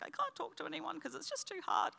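high, soft, mock-tearful voice; retold overwhelmed little whimper; teary, emotional. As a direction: squeak it out quietly as if near tears. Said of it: "I was very teary and emotional".

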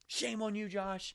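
high, mock-stern scolding; a hint of a stern father scolding; scolding God. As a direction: wag a finger as a disappointed God. God scolding a sinner, acted.

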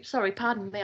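usual speaking voice

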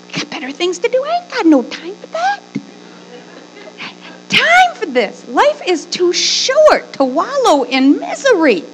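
high, emphatic and exasperated; high-pitched, animated scolding of the kids; exasperated motherly pep talk. Acted. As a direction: push each phrase up high, waving off misery. Preacher voicing advice to her kids.

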